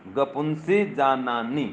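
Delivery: slow, drawn-out syllable by syllable; slow mnemonic recitation. Shown as a demonstration. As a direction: recite it slowly for students to memorise. Teacher recites mnemonic to be memorised.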